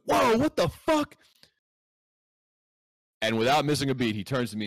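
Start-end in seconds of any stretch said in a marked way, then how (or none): 0.1-1.2 high, loud, drawn-out mock shock; imitated shocked yell. Boss seeing the flooded lobby, shocked yell acted out.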